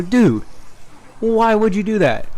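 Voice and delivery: high-pitched retold disbelief; acted-out exasperated plea, high; incredulous protest. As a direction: demand an answer, voice jumping up. Retold protest at spiked tea.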